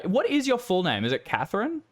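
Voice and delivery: lifted, questioning guard. Acted. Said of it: "he runs a finger down and goes".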